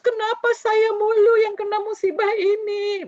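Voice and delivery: loud, high-pitched wailing complaint; hammed-up loud lament; mock wailing. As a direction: bawl it out in exaggerated self-pity. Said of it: "meraung-meraung".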